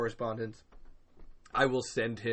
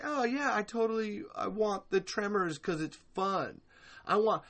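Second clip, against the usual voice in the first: lightly raised, sing-song fake-fan voice; mocking fan voice; 9 semitones higher than usual. Host mocking fans who want more Tremors, mock gush. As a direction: poke fun at a fake fan's enthusiasm. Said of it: "turn around and be like".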